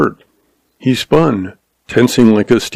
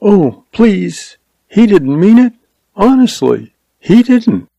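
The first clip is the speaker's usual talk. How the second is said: put-on high, pleading young woman, a touch of tremble; voice shaking; 11 semitones higher than usual. A narrator's reading. Schoolteacher pleads with gunman for her brother, shaky voice. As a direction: beg him to believe her brother meant no harm. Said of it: "Her voice shook".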